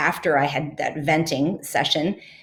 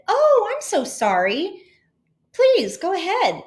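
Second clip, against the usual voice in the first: polite apology; 13 semitones higher than usual. Retold or imitated. Apologizing politely to angry man.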